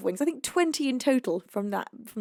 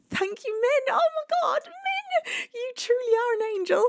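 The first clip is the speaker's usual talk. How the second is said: excited scream; 12 semitones higher than usual. Real, not acted. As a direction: shriek with joy for the gift. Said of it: "I'm screaming my voice back".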